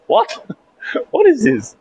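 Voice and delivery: high, squeaky what; disbelief. Real, not acted. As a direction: shriek your total bafflement. Baffled by a courtesy call.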